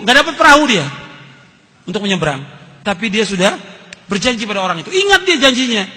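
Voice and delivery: ordinary talk, nothing marked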